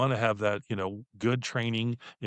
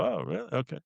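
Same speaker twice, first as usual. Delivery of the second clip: higher and softer, shrugging it off; careless brush-off; 5 semitones higher, 5 dB quieter than usual. Retold or imitated. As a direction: toss it away like nothing matters. Mimicking an indifferent brush-off.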